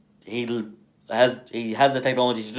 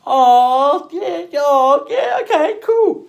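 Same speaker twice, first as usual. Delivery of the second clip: much higher and louder, breezy fake enthusiasm; mock casual; 9 dB louder, much slower than usual. Reacting to the tattoo fix, high loud mock cheer. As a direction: squeak out a fake-cheery okay.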